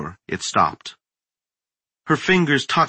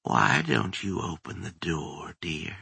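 softer, coaxing crone voice; sweet sinister old woman; 4 semitones lower, 9 dB quieter than usual. A narrator's reading. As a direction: lure her in like a kindly granny. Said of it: "forced up into the higher register of an elderly woman".

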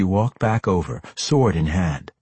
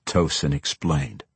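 plain reading voice

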